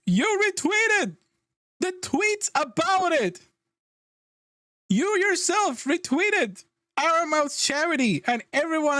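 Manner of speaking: high, slow, disbelieving rant; incredulous outrage. Real, not acted. Ranting at the CEO's excuse, high and slow. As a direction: stretch every word in shocked disbelief.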